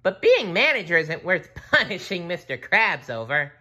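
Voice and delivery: high, loud cartoon protest; high-pitched cartoon hero objecting; SpongeBob voice. A narrator's reading. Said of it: "said SpongeBob".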